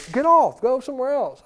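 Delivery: put-on high whiny child snapping at his sister; bratty kid. Boy snapping at his little sister, high and loud. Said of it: "I don't allow Colton to go".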